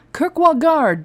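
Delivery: loud, high bark. Acted. A guard barking back at the intruder. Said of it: "Donan barked back at her".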